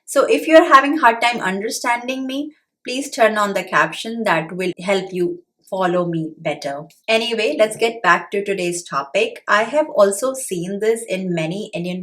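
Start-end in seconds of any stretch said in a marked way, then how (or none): none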